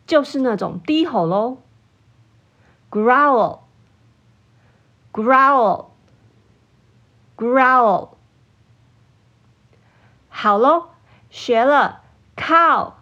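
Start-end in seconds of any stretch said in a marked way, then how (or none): 2.9-8.1 a hint of an angry dog's low growl in "Growl". Shown as a demonstration. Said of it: "回想一下狗狗生气的时候发出的声音". (带点狗狗生气低吼的味道念"Growl")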